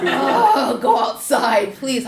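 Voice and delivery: loud pleading through laughter; laughing plea. Retold or imitated. Urging the kids outdoors, laughing loudly. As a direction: beg them to go outside while cracking up.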